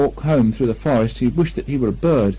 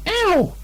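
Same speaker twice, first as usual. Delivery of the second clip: very high squeaky ow; high piglet ow; piglet cry. Acted. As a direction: yelp at the scrubbing. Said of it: "cried Piglet".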